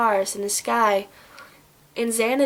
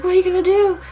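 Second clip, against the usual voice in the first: higher, mock-tearful movie heroine; acted upset, pitched up; imitated tearful plea; 8 semitones higher, 4 dB louder, much faster than usual. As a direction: plead as if close to crying. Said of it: "a lot more sad 'cause she's, like, crying".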